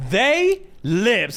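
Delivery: high, drawn-out excited "They lipsed"; thrilled, high-pitched announcement; excited reveal. Real, not acted. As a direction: hoot the gossip out, stretching "They". Repeats the kiss reveal, thrilled.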